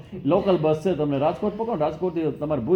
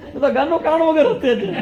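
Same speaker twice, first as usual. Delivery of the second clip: laughing; 9 semitones higher, 5 dB louder than usual. Retold or imitated. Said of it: "મને હસવું આવે".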